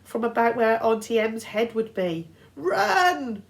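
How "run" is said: high loud bleat. A narrator's reading. Satyr bleating at his friend to flee, high and loud. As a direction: bleat it in panic. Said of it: "Grover bleated".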